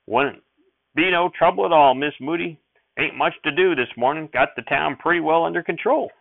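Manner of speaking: high, loud folksy aw-shucks; bashful sheriff. Acted. Sheriff waves off Mother's thanks in a bashful folksy voice. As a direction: brush off the thanks like a shy country lawman.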